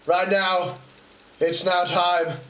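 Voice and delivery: ordinary delivery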